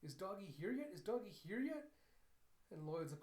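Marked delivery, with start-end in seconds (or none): 0.0-1.9 high, impatient question rising sharply at the end; fidgety child. Retold or imitated. Child fidgets waiting for the dog, impatient pleading. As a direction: ask like a kid who cannot wait. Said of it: "kinda just fidgeting".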